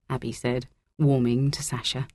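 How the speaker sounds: ordinary delivery, nothing marked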